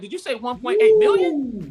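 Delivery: high, incredulous question; double-check a shocking number; inner disbelief. Retold or imitated. Retelling inner shock at figure.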